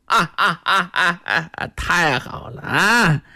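gloating villain laugh. Acted. Villain gloats over captured enemy.

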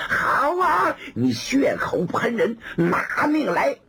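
high angry outburst, then slow deadly threat; furious cry. Acted. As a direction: scream the accusation back, then growl the challenge. Gao Feng attacks.

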